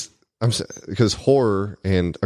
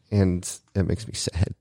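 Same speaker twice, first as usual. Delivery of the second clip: ordinary delivery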